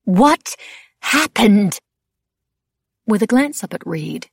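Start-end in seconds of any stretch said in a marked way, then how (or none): 0.0-1.8 loud exasperated demand, pitched up; Madame Labelle, exasperated. A narrator's reading. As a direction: throw up your hands and demand it loudly. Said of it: "wearisome in her exasperation".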